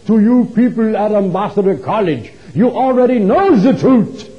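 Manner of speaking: put-on loud, high-pitched thick German accent. German author addresses the college audience, thick German accent, loud. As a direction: imitate a heavyset German orator proclaiming loudly. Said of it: "in his thick German accent".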